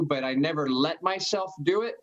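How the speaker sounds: ordinary talk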